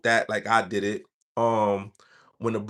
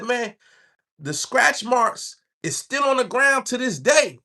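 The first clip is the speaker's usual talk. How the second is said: higher, mock country drawl reliving a stabbing; 10 semitones higher, slower than usual. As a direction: lightly play the gleeful inmate.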